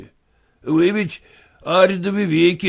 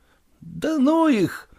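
медленно и разочарованно, голос выше; вяло отмахнуться от мечты; 10 semitones higher, much slower than usual. Acted. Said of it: "разочарованно пробормотал Куцин". (Куцин разочарованно отмахивается от тётки, медленно)

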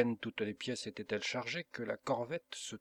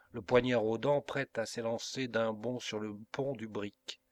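ordinary delivery, nothing marked

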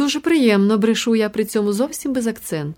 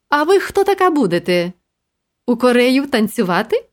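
вище й голосніше, по-простецьки радісно; радісно зачепити незнайому жінку; радісне питання дядечка; 4 semitones higher, 3 dB louder than usual. Acted. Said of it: "радісно питається він". (радісне запитання простого дядечка)

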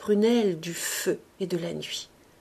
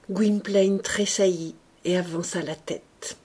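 ordinary delivery, nothing marked

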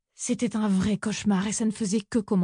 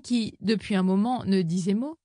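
usual speaking voice

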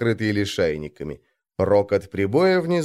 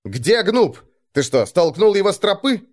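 high-pitched, alarmed angry questions; barked questioning; 9 semitones higher, 3 dB louder than usual. A narrator's reading. Arngrim asks whether Gnup was pushed off, barking. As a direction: demand answers, pitch climbing. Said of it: "рявкнул Арнгрим".

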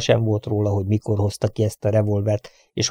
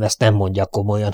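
usual speaking voice

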